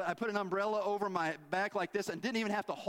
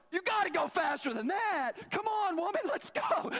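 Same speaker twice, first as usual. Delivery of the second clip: high, loud impatient yell at his wife; frustrated yelling; 12 semitones higher, 3 dB louder than usual. Retold or imitated. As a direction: hurry her up, shouting over the rain.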